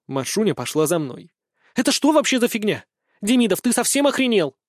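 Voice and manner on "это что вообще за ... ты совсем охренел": high-pitched affronted challenge; indignant outburst. A narrator's reading. Girl follows the boy who refused her, high and indignant. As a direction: demand an explanation, outraged. Girl's outraged challenge in quarrel.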